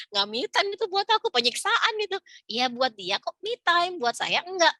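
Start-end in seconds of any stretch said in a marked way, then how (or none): 0.1-2.2 high-pitched exaggerated objection; mock protest. Mother protesting a friend's idea of me time, mock dismay.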